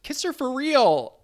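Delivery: put-on high, slow old-lady voice; a high, slow, bossy grandma voice.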